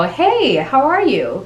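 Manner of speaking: high, bright rising "Hey"; friendly up tone. Shown as a demonstration. Coach demoing an up-tone greeting to build rapport. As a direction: pop "Hey" up high, then a rising "how are you?". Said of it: "So that's when you go".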